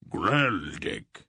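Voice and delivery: hearty bellow of a friend's name; roared greeting. A narrator's reading. Berak roaring back at the captain, a mild roar. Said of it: "Berak roared in response".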